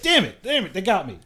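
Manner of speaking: high, loud comic outburst; hammed-up exasperated cry; mock frustration. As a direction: curse it like you've been had. Acting out falling for an ad.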